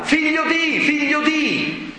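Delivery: high-pitched calling out; God calling, pitched well up; acted divine cry. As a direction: call it out twice, stretching the repeat. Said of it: "Quindi Dio gli urla".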